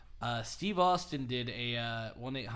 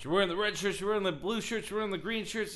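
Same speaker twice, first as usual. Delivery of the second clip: high, sing-along list of insults; taunting wrestler; 8 semitones higher, 4 dB louder, faster than usual. Retold or imitated. Wrestler mocking Cena's colorful shirts, taunting. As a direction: rattle off the taunt with mock scorn. The Rock taunting Cena, retold.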